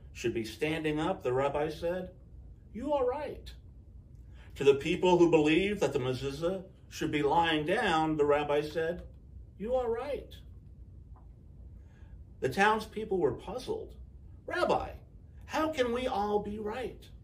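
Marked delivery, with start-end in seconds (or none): 2.7-3.5 rabbi's voice. A narrator's reading. Rabbi answers one side. 9.6-10.4 rabbi's voice. A narrator's reading. Rabbi answers other side. 14.5-17.1 puzzled. A narrator's reading. Puzzled townspeople ask rabbi.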